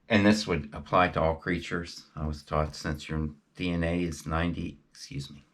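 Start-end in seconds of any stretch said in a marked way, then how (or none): 4.9-5.4 whispered aside. Real, not acted. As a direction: drop to a whisper for the apology. Whispered apology mid-sentence.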